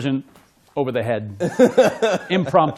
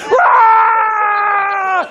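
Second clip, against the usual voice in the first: high, loud mock roar; excited crowd roar, shouted high; imitated crowd roar; 7 dB louder than usual. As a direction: roar like a crowd going wild.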